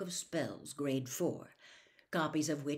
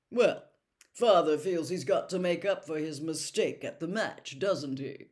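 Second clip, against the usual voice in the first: put-on pompous young man; 6 dB louder than usual. A narrator's reading. Percy voiced, pompous.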